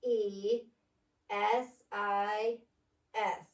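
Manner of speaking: letters slow and separate, T H E S I S; each letter its own beat; spelled out slowly. Shown as a demonstration. Teacher to pupil, spelling the word out slowly. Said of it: "T H E".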